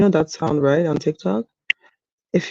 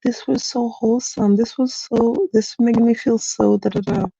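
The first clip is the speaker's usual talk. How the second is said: higher gushing comment voice; lightly mocking wholesome-gushing commenter; 7 semitones higher than usual. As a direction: imitate the syrupy commenters, pitched up. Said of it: "the comments were like".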